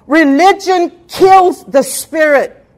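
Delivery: slow, loud, hammered declaration; loud slow pulpit cry; preaching shout. Acted.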